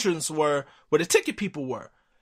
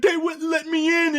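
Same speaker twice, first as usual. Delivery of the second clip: put-on much higher, whiny kid voice; upset kid; 13 semitones higher than usual. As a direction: whine it like a let-down boy. Crying son tells dad.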